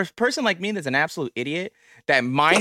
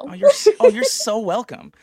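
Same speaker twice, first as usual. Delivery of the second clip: excited; 11 semitones higher, 5 dB louder than usual. Real, not acted. Host gushes back.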